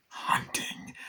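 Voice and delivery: hushed, voiceless mock menace; sinister whisper. Chucky with knife out to Glenn, sinister whisper. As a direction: whisper it like a killer with a plan.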